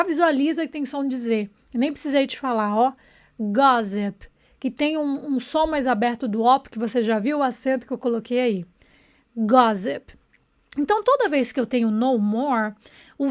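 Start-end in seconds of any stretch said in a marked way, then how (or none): 3.4-4.3 "Gossip" with open first vowel and a Z sound; pronunciation model. Shown as a demonstration. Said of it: "olha a pronúncia". 9.3-10.1 "Gossip" with open first vowel and a Z sound; pronunciation model. Shown as a demonstration. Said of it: "olha a pronúncia".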